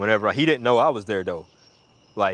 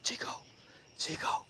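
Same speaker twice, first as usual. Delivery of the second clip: acted-out whisper from hiding; secretive, low-volume calling; hushed call; 14 dB quieter than usual. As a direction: softly hiss a name for attention. Hiding, calls softly to friend.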